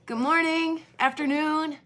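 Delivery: bright, high friendly hello; cheerful greeting. Shown as a demonstration. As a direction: greet her brightly, like a kid. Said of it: "You could say".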